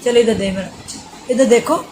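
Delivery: mother hushing her crying son late at night, loud and pleading. Real, not acted.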